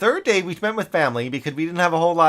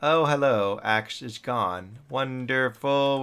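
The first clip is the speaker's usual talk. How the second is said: ordinary talk, nothing marked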